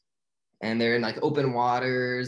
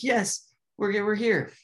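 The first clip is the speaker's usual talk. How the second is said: much higher, thrilled; joyful arrival; 10 semitones higher, slower than usual. Retold or imitated. Marlin and Dory landing in Sydney harbor, joyful. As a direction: celebrate finally making it.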